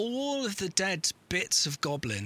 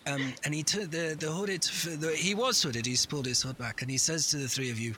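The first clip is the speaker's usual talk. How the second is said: ordinary talk, nothing marked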